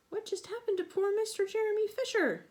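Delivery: usual speaking voice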